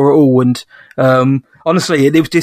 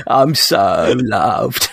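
mock dreamy; 6 semitones lower, much slower than usual. Co-host mocks host's dreamy state.

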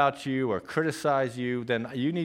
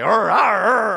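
mock dog growl in tug of war; playful growl; 9 semitones higher, 12 dB louder, slower than usual. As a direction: growl like playing with a puppy.

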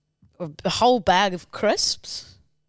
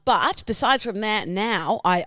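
ordinary talk, nothing marked

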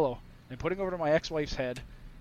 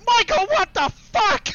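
high, loud, slow shocked outcry; shocked wife; 7 dB louder than usual. Retold or imitated. Wife waking to a soiled bed, shrieking in shock. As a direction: mimic her horrified shriek. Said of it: "She went".

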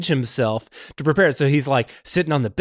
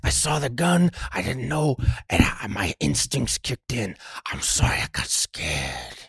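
sheepish apology; 2 semitones lower, 6 dB quieter than usual. Retold or imitated. Retells cop pleading.

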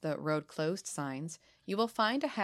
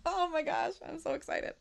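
much higher, thrilled squeal; real excitement; 11 semitones higher than usual. Real, not acted. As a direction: burst out thrilled at winning. Wins the school bus legend.

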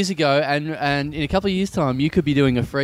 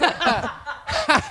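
high laugh; 10 semitones higher than usual. Real, not acted. Big laugh.

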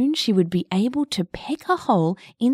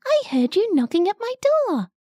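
high, cheery little bug voice; bright and friendly little caterpillar; 7 semitones higher than usual. Acted. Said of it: "a cheery little caterpillar ... said".

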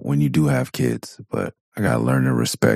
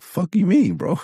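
incredulous dad. Retold or imitated. Dad scoffing at his kid's nightmare, high and incredulous. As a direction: squeak it out in disbelief.

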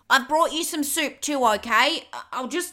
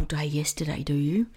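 guilty whisper; 11 semitones lower, 4 dB quieter than usual. Acted. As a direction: whisper it sheepishly. Guilty confession.

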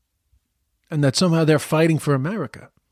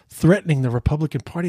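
ordinary delivery

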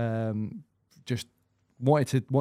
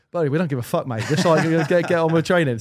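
louder and higher, fed up with the chat; exasperated telling-off; 5 semitones higher, 7 dB louder than usual. Retold or imitated. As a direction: tell a teammate to stop talking and train, raised voice. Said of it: "And that's like".

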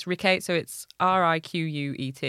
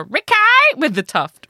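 broad Cockney Ricky with the Tuft; louder, hammed-up EastEnders Cockney; EastEnders accent; 10 semitones higher, 12 dB louder than usual. Shown as a demonstration. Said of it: "full EastEnders and go".

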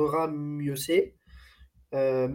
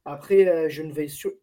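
normal talking voice

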